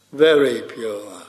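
very pushed out firmly, then pure falling away; stress on very. Shown as a demonstration. Preacher to congregation, emphasis on very. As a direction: lean on the word very for emphasis. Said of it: "For emphasis".

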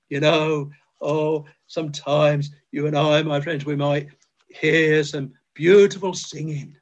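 ordinary delivery

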